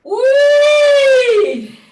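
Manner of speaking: high loud siren whoop; joyful loud wee; playful whee. Shown as a demonstration. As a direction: swoop up like a siren, full voice. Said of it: "Let it be a playful sound".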